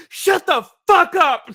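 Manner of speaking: high loud shout, slowed; mock furious shout; yelled retort. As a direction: bark it back, hammed up. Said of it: "And Eddie Griffin's like".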